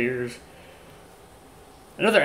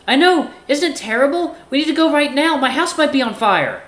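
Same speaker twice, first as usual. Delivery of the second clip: high, loud, faltering fake worry; hammed-up panic; 7 dB louder than usual. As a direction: confess in overplayed alarm. Axel fakes panic about fire.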